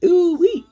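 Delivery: very high, loud hooting whoop; excited whoop. Real, not acted. Vlogger shouting her joy at a memory, high whoop. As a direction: let out a delighted hoot.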